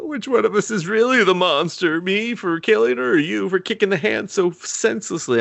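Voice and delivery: high, theatrical mock; mock melodrama. Mocking Al's overwrought monster speech, high and theatrical. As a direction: ham it up like a soap villain.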